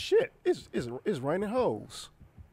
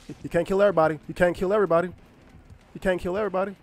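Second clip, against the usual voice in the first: louder, insistent protest at the killer; 4 semitones higher, 6 dB louder, much faster than usual. Real, not acted. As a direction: shout it, almost pleading. Yelling at the killer.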